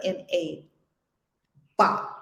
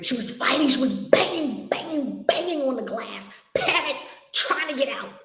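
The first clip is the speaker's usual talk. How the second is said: higher, urgent mock-panicked retelling; acted-out panic; 5 semitones higher than usual. Storyteller describes woman banging on the glass, mock panic. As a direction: tumble through it, imitating frantic struggle.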